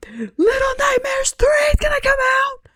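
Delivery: high, loud shriek of joy; excited shout. Real, not acted. Thrilled vlogger shrieks the game news to viewers. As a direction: scream the news at full pitch. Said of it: "I'm so excited".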